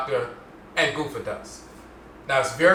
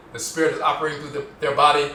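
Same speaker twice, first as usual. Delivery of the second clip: ordinary talk, nothing marked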